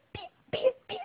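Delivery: pío muy agudo y suave; pía como un pollito; piar de pollito. Shown as a demonstration. Imita al pollito. (juego de sonidos para niños, piar suave)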